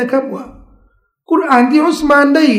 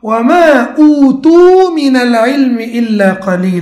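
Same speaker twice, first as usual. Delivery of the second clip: chanted Arabic verse, a little louder; Quran recitation; 4 dB louder than usual. Shown as a demonstration. Teacher chanting the verse for his class in melodic recitation. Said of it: "นบีก็อ่านวะฮยูที่รับมา".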